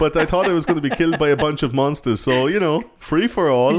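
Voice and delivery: high mock German accent; old German guy.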